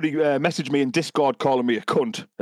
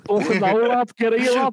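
a hammed-up Scouse shout, much higher and louder; mock Scouse accent; 9 semitones higher, 3 dB louder than usual. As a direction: bark it like a Scouse lad. Imitating a player's accent.

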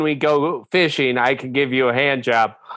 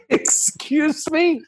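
floored by the news; shocked; 7 semitones higher than usual. Acted. Laughing shock at affair reveal.

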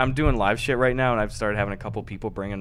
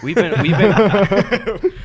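laughing; 8 semitones higher, 7 dB louder, much slower than usual. Real, not acted. Laughing through joke.

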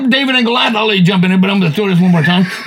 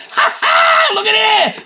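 much higher, shouted mock crowing; gloating David; slower than usual. Young David taunting the giant, shouted gloat. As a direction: crow it like a cocky kid. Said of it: "David was going".

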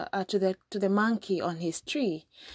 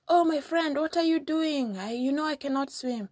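exclaiming; 8 semitones higher than usual. Acted. Monkey realizing the crocodile is sinking him, startled exclamation. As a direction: cry out in sudden alarm. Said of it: "went exclaimed".

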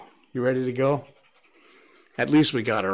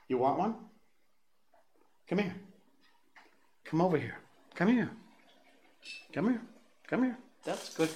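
dog coaxing; 6 semitones higher, 6 dB quieter, faster than usual. Real, not acted. Calling the reluctant dog.

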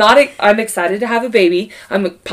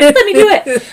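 eager sister; 12 semitones higher, 7 dB louder than usual. Retold or imitated. Sister voiced in birth story.

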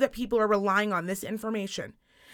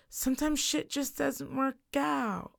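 slow, soft, higher-pitched imitation; mock laid-back friend; 4 semitones higher, 7 dB quieter, slower than usual. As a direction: draw it out softly, mimicking a chilled friend. Imitating friend telling her to relax.

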